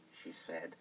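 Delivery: plain reading voice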